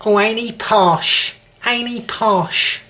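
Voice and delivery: high, drawn-out London Cockney jeer; Cockney relatives teasing. Retold or imitated. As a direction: mimic Cockney relatives mocking someone posh. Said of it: "all my family at weddings".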